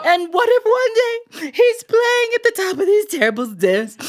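high, wailing, tearful wife's voice; weeping wife. Acted. Wife weeping to the grocer about an imagined son, high wail. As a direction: wail it out like a distraught mother.

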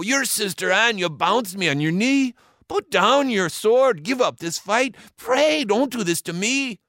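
high-pitched, urgent begging; Dirty Joe, begging for mercy; pleading pirate brother. A narrator's reading. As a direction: plead with your big sister. Joe pleads with his sister.

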